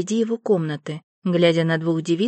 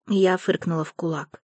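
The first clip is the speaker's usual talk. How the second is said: plain reading voice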